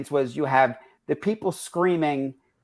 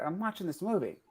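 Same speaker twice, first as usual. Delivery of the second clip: quiet aside; 5 semitones higher, 10 dB quieter than usual. Retold or imitated. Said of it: "I go, like".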